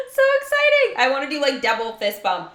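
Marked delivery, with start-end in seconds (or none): 0.1-1.0 high, loud excited squeal; thrilled squeal. Real, not acted. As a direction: burst out with delight.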